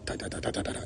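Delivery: soft, unvoiced keyboard tapping; imitated typing. Mimicking someone typing out a post, soft rapid taps. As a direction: tap out little toneless "ta" noises.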